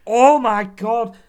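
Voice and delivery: loud, high, drawn-out outburst; shocked exclamation. Real, not acted. Co-host reacting to the match, shocked exclamation. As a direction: stretch it out in loud disbelief.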